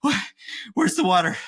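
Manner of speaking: a touch of pretend panic mid-workout; mock winded panic. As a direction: play it hammed-up, out of breath and desperate. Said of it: "you're winded going".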